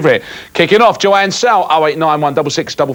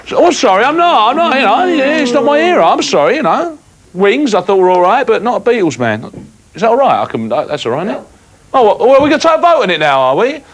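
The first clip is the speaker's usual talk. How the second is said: higher, huffy hammed-up protest; mock indignant; 7 semitones higher, faster than usual. Host pretending to be wounded by crew disagreement. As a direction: play at being offended. Mock offended at being challenged.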